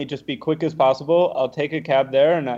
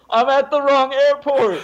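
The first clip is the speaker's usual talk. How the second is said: high, loud frantic shout to the crowd; panicked yell; 11 semitones higher, 6 dB louder than usual. Retold or imitated. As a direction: bellow it so everyone clears the way. Yelling from top of escalator.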